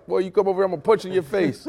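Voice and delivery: lightly voiced loud threat, fast at the end; imitating Channing Crowley. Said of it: "Channing Crowley".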